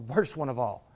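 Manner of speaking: worst one of all dropped low and soft; quiet echo. Shown as a demonstration. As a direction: repeat it hushed, like an echo. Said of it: "And the worst one of all".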